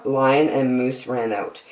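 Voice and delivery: ordinary delivery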